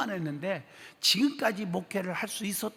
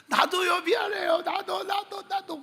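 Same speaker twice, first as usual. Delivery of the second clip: much higher, loud sobbing cry; crying apology; 13 semitones higher, 3 dB louder, slower than usual. Retold or imitated. Pastor weeping back at the deacon, sobbing cry. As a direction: cry it out, overwhelmed. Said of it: "같이 우는 거예요".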